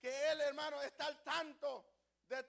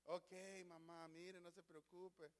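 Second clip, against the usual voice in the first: soothing son; 7 semitones lower, 14 dB quieter than usual. Acted. Patient son soothes his mother.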